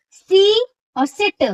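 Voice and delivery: सी drawn long, सिट cut short, both slow; teacher contrasting vowel lengths. Shown as a demonstration. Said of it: "तो बहुत अंतर आ रहा है".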